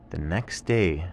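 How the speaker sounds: ordinary delivery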